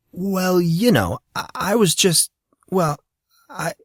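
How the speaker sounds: stammering, flustered. A narrator's reading. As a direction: grope for words and trail off. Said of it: "Monson's reply died off".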